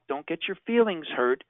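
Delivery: ordinary talk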